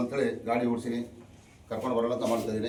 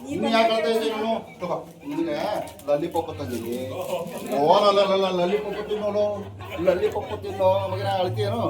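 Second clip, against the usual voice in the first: put-on coaxing crying child; 5 semitones higher, 5 dB louder than usual.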